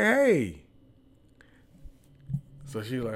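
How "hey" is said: a bright, high hey; cheerful hello. Retold or imitated. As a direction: call out a friendly hey.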